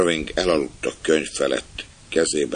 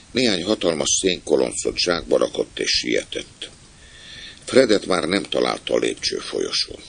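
plain reading voice

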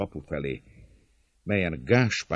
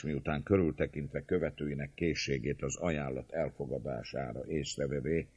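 plain reading voice

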